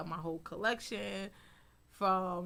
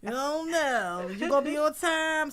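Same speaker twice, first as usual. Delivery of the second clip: loud, high teasing imitation of the staff; mock the crew, laughing; imitating the crew; 7 semitones higher, 10 dB louder than usual. Backstage crew teasing the designer about being late, loud and laughing. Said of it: "they know me so good that they know, like".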